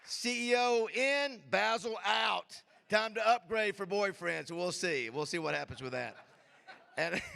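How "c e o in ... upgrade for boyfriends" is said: loud, high, slow teasing shout; playful hype shout. Real, not acted. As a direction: ham it up for the crowd. Joking crowd shout-out.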